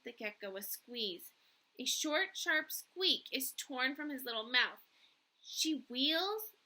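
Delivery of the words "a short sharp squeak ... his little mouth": plain reading voice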